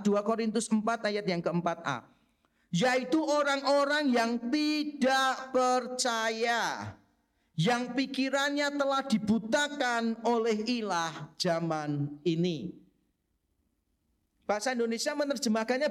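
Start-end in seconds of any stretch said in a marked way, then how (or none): 2.7-12.7 slow scripture reading. Acted.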